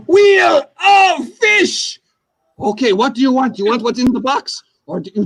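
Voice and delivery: loud, high, stretched announcer call; hammed-up game show host shouting the title. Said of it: "That's my impression".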